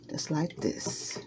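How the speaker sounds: a slow whisper while stirring; whispered aside. Real, not acted. Cook whispering to viewers at the bowl, slow. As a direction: drop to a whisper and show them. Showing the oil blended in.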